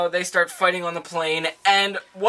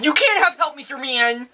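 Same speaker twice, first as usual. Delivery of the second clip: high, put-on girly voice; hammed-up refusal, pitched way up; mock heroine; 7 semitones higher than usual. Acts out Felicity answering.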